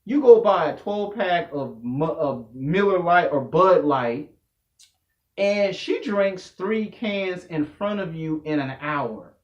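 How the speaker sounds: ordinary delivery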